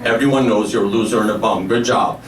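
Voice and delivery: low, deadpan dad voice. Retold or imitated. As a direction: put him down in a low voice. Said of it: "my dad's like".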